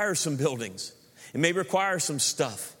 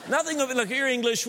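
ordinary talk, nothing marked

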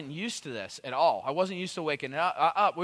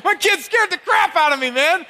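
high, loud, still half screaming; worked-up exclamation; 12 semitones higher, 11 dB louder than usual. Retold or imitated. Comedian tells crowd of toddler scare, shouting high. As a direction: shout it in exaggerated leftover fright.

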